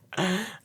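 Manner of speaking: short high giggle at her own slip; a quick, surprised little laugh; amused giggle. Real, not acted. Reader laughing after fading the card by mistake, high giggle. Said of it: "That's funny".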